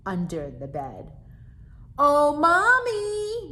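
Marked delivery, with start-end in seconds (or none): 2.0-3.5 high, loud, slow drawn-out call. Acted. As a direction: call mommy from the bedroom. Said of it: "they both called together".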